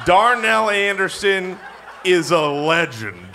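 slow words through laughter; laughing. Real, not acted. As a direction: laugh while you say it. Laughing at the joke name.

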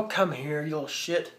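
normal talking voice

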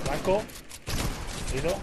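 high, loud call to teammates; shouted callout. Real, not acted. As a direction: shout the target out sharply. Calling out target in game.

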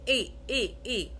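إي as French é, "ay"; closed E. Shown as a demonstration. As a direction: say each إي separately as "ay". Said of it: "شفت أنا فتحت".

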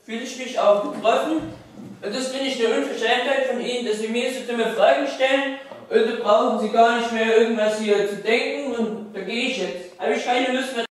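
loud, offended interviewee walking out; indignant outburst. Acted. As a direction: tell the reporter off, loudly. Offended player storms out of interview. (empört und laut)

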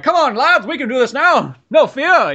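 high, loud British rallying cry; hammed-up British war cry; British officer rallying. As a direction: rouse the troops like an old British officer. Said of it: "kinda British, infantry troop who's like".

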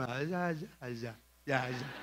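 soft, dismissive mock groan. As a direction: brush it off like a tired believer. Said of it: "Me encanta el cristiano como". (el cristiano fastidiado, quejido suave y burlón)

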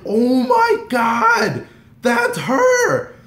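loud, drawn-out shriek way up high; reliving it in a starstruck squeal; voice going high. Retold or imitated. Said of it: "My voice is going high".

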